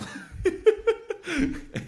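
loud high cackle of laughter; high shrieking laugh. Real, not acted. Big laugh at memory.

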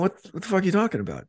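fast, high disbelieving retort; incredulous. Retold or imitated. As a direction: blurt it out quickly in disbelief. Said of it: "And I went".

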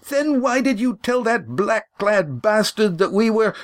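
high, furious accusation; angry demand. Acted. Lindy accusing Walt of betrayal, high and furious. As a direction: hurl the question at him. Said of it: "Lindy swung the boots".

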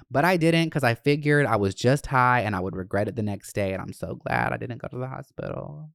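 ordinary talk

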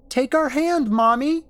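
put-on loud, very high little kid; high-pitched, pleading child's call. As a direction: urge Mommy on like a small child. Child voice beckons mother again.

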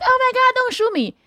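squealing high mock-terror; mock panic. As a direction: play a coward begging for his life. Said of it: "little bitches panic".

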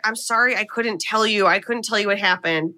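ordinary delivery

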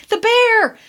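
put-on high, panicked child's shriek; high alarmed child's cry; frightened child screaming. A narrator's reading. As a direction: shriek it like a terrified kid who's just seen a bear. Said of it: "all screaming at once".

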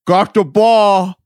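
slow, thick-mouthed slurred imitation; dazed injured player. Bloodied outfielder answering teammates, slow garbled triumphant imitation. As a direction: draw it out as if through a hurt mouth.

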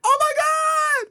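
very high, slow shocked shriek; reacting to the cucumber; shocked scream. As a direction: shriek in disbelief.

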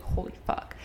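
a breathy, awed whisper; stunned whisper. Retold or imitated. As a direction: whisper it, too shocked to speak up. Friend finds his Instagram.

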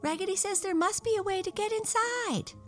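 very high-pitched little doll chorus; tiny dolls crying out together; excited doll cry. Acted. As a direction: pipe it up high, eagerly echoing the leader. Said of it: "cried all of the dolls".

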